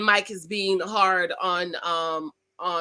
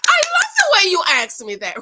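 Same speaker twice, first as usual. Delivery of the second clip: delighted gushing; 9 semitones higher, 9 dB louder than usual. Retold or imitated. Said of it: "I was like".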